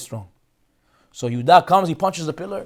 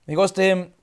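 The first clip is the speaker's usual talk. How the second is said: normal talking voice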